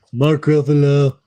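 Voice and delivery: a hint of Mark Ruffalo's voice; Mark Ruffalo impression. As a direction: lightly mimic Mark Ruffalo. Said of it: "you were the one who did it first".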